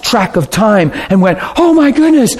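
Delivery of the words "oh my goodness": high, startled exclamation; mock alarm. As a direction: act out suddenly noticing the time. Retells couple realizing they lost time.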